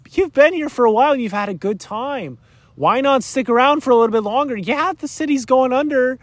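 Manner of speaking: a buddy-buddy tempter, sweet-talking you into staying; tempter's coaxing. Acted. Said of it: "that's exactly what Satan's gonna wanna do".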